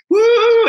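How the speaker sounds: long, high, loud "woo"; cheering whoop. Retold or imitated. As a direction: imitate a crowd's whoop, sustained. Imitates addicts applauding and cheering.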